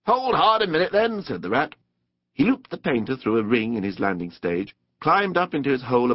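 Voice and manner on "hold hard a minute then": high-pitched, hearty check; restraining water rat. A narrator's reading. Rat holds back the eager Mole, hearty. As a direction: rein in an eager friend.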